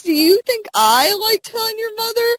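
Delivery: high-pitched whiny dad voice, pleading; mock Hal. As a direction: squeak it out like a flustered dad. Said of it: "he goes, he goes".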